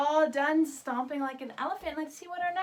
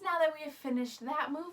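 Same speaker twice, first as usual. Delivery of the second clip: ordinary talk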